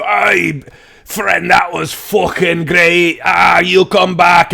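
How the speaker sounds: put-on hollering drunk dwarf praising the performer; tipsy dwarves roaring their thanks; drunken dwarf shouting.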